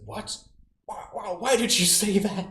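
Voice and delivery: high, loud, disbelieving partner; startled partner. Retold or imitated. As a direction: gasp it out in disbelief. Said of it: "your partner says".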